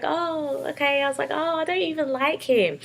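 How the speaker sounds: flustered denial. Retold or imitated. Sister denying a crush to her brother, flustered. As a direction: play it off, embarrassed.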